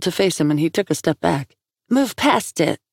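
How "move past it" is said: much higher, scornful echo of his words; incredulous angry echo. Acted. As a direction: throw his words back at him, indignant. Echoes his words angrily.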